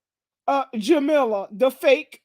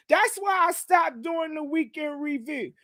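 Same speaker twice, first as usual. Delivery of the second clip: ordinary delivery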